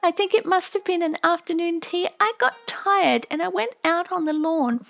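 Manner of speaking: put-on a young daughter longing for home; little girl remembering. A narrator's reading. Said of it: "daughter's long remembered speech".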